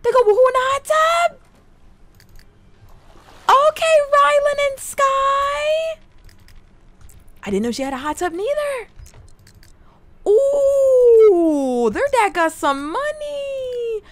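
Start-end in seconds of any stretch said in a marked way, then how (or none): 0.0-6.0 very high, thrilled squeal; excited squeal. Real, not acted. Player reacting to the couple in the hot tub, excited. 8.3-14.0 high, stretched-out ooh of awe; impressed squeal. Real, not acted. Player admiring the dad's wealthy house, impressed.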